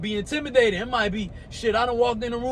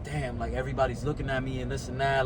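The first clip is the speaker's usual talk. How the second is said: timid inner thought; 8 semitones lower, 6 dB quieter than usual. Retold or imitated. His old unconfident self.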